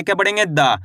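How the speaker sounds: normal talking voice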